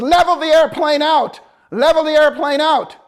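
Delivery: loud, high instructor order; barked command. Retold or imitated. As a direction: bark it like a flight instructor.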